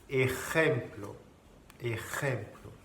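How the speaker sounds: "ejemplo" with J rasped in the throat; Spanish throat J. Shown as a demonstration. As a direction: say "ejemplo" slowly, scraping the J. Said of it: "mais plus profond". (« ejemplo » avec la jota en gorge)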